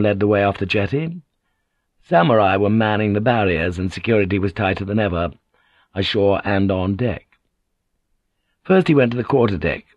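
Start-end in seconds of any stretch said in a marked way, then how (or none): none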